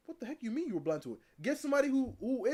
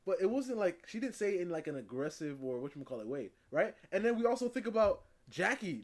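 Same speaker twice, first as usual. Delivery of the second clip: ordinary talk, nothing marked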